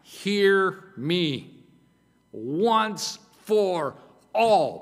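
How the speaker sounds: loud, slow, drawn-out pulpit cry; screaming out. Acted. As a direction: pound each word out slowly and loudly, preacher-style. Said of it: "like it's screaming out".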